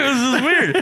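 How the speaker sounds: high laughing aside. Retold or imitated. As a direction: giggle the words out. Retold thought, laughing.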